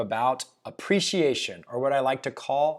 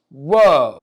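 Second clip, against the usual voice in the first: loud, hammed-up whoa of amazement; mock-amazed exclamation; excited whoa; 12 dB louder than usual. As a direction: blurt it out, thrilled. Mock thrill at house gain.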